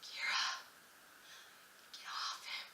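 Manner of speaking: urgent whispered reprimand; mom's hushed scolding. Retold or imitated. Mom to daughter on a stranger's back, whispered scolding. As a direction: whisper it like an embarrassed parent. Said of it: "my mom looked at me and went".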